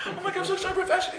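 very high, rapid squeal with laughter; hammed-up giddy excitement; overexcited. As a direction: gush like a giddy fan. Imitating overexcited friend.

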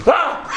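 high, loud startled ah; startled cry. Retold or imitated. Startled when the voice offers fifty, a high yelp. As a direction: yelp like you've been caught off guard.

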